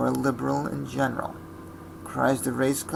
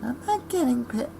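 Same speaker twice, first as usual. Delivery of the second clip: high innocent contestant voice; 10 semitones higher than usual. Pretend. Mocking contestant denying pay.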